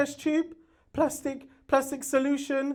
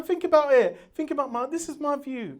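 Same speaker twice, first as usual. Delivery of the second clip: ordinary delivery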